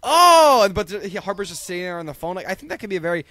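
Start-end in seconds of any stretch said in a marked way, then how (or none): 0.0-0.6 sudden loud high "Oh!"; surprised exclamation. Retold or imitated. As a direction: react with sudden realization. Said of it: "then he goes".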